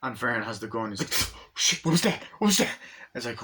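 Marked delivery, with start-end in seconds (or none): 1.3-2.7 high, jumpy panic; spooked. Acted. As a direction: hiss the first words, then rise in alarm. Vern on watch, spooked.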